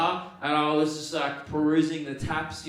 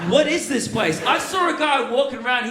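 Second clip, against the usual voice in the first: amazed; 6 semitones higher, 5 dB louder than usual. Retold or imitated. Retold amazement at Big Tesco.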